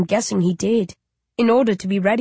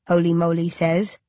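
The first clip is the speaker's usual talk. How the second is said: ordinary delivery, nothing marked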